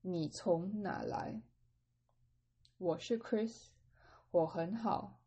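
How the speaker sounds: slow, careful Mandarin dialogue for learners; slow model reading. Shown as a demonstration. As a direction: read it slowly so students can follow. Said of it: "I'm gonna repeat B".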